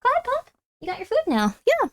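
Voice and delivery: high cooing pet voice; soft high pet talk. Real, not acted. Talking to her cat.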